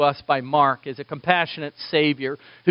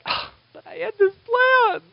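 high, mock whine; much higher, mock annoyed voice; whiny complaint. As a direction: whine like someone whose plans got ruined. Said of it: "you're like".

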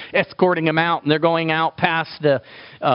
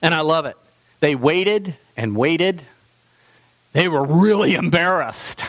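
usual speaking voice

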